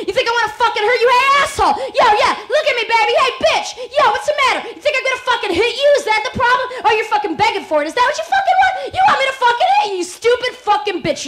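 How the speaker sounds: loud, fast, high shouting threats; abusive man yelling. Acted. Abusive man threatens the woman, furious loud shouting. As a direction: scream it at her like a violent brute.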